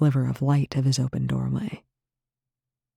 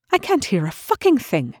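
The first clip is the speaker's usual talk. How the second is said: higher, half-deaf overloud voice; too loud; 7 semitones higher than usual. Acted. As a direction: talk over ringing ears. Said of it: "Rush said too loudly".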